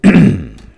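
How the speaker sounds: loud throat clear. Real, not acted. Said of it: "I've got a frog in my throat".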